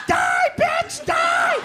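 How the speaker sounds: high, drawn-out squeal; hammed-up berserk kid shriek; mock murderous scream. Mark attacking his brother.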